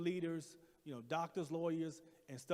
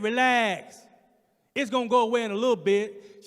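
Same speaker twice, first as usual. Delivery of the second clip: louder, higher impatient order; curt nurse; 5 semitones higher, 13 dB louder than usual. Retold or imitated. Frustrated nurse dismissing the patient again. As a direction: snap it off dismissively. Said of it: "She said".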